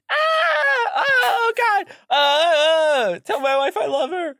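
screaming in terror, voice cracking; panicked cries as flames hit; terrified screaming. Acted. Announcer dying in fire.

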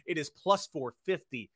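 ordinary delivery

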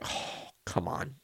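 soft, breathless whine of defeat; whispered mock protest. Uncle giving up to his toddler nephew, whispered. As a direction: sigh it out as if caught. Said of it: "And I was like".

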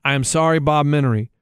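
ordinary talk, nothing marked

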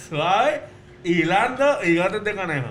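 slurred consonants; garbled mouth-game speech. Real, not acted. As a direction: make yourself understood with lips pulled open. Mouth game, partner guesses distorted phrase.

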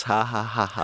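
deliberate spoken fake laugh; mock laugh. Co-host flags his own cinematography pun, mock laughing. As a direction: say each "ha" separately, as a put-on laugh.

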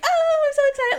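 very high giddy squeal; uncontainably excited. Retold or imitated. Imitating herself on the way to the winery, uncontainably excited. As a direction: act out bursting with excitement. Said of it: "I could not contain myself".

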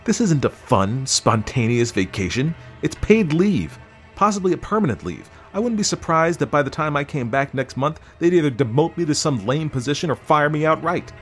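high, aggrieved husband, slowing for scorn; angry reproach. A narrator's reading. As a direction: berate her over your ruined career. Husband angry about career.

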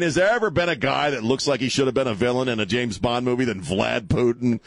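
normal talking voice

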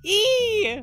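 squeaky high E; high squeal. Shown as a demonstration. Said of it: "one of my favorite noises to make is".

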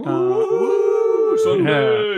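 high whooping cheer. Pretend. Bandmate hyping Sunday's festival show, high cheer. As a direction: whoop high, then call out the day.

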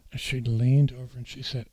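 ordinary talk

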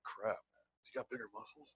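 low, very soft aside; hushed worry. Acted. Man sizing up a deep-voiced rival, hushed worry. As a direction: mutter it under your breath.

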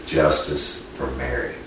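Justice for Mary, slowed down with a firm falling finish on Mary; title announcement. Shown as a demonstration. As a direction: unveil the title, leaning on Justice. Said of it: "So we're gonna name the title".

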